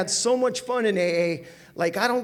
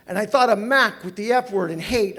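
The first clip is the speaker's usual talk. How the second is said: normal talking voice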